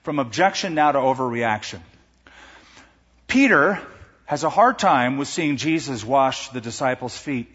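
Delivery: ordinary delivery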